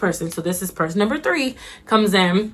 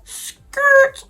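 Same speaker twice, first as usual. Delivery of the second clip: high-pitched "skrrt" of braking tires; imitated record-stopping screech; tire-screech noise; 4 dB louder than usual. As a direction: screech to a halt vocally. Imitates screeching brakes for shock.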